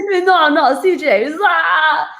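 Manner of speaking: high zombie wail; Joey zombie impersonation. Retold or imitated. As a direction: growl out a zombie cry. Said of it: "was that your Joey impersonation?".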